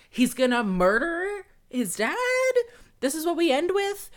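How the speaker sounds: high, loud cry of disbelief; a real yelp of horrified surprise; outraged disbelief. Real, not acted. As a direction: shriek it like you can't believe the ending. Outraged reaction to the movie ending.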